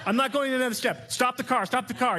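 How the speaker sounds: high, panicked mock wife yelling to stop the car; high-pitched mock panic from the passenger seat. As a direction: play the frightened wife demanding the wheel. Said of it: "begins to just yell at you".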